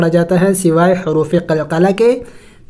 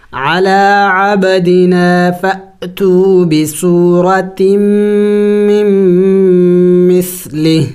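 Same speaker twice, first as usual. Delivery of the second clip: Quran recitation. Acted. Continues recitation.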